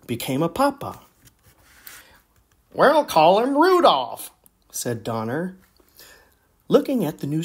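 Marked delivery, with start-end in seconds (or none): 2.7-4.3 higher, louder proud dad; proud reindeer dad. Acted. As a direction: announce the name with pride. New father reindeer names son.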